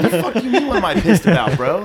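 angry laughing yell. Retold or imitated. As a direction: shout it back, furious through a laugh. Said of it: "I was like".

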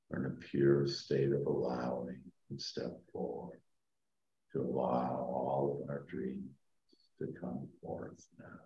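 slow, soft meditation voice; sleep story narrator. Acted. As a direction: guide them down gently, fading to a whisper. Leads a guided meditation.

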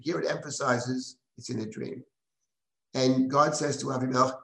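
ordinary talk, nothing marked

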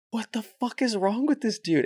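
high-pitched imitation of baffled classmates; mock bewilderment. Acting out the bewildered class's reaction.

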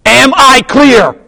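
slow, high shouted demand; Jack Nicholson. Retold or imitated. Officer on trial repeats himself to the lawyer, shouted demand. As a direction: bellow each word, imitating the film.

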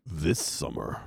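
low, soft trailer voice-over; lurid grindhouse trailer growl. Shown as a demonstration. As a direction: tease it like a trailer opening. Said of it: "a movie phone or, like, a trailer".

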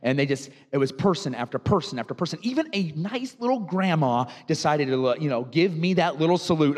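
normal talking voice

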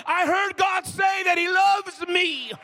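loud, high, drawn-out preacher's cry; exultant shout. Acted. Worshipper declaring God's love in church, exultant shout. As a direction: belt it out slowly, high and triumphant.

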